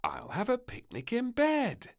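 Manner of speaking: put-on high, eager little girl; squeaky excited child; little princess voice.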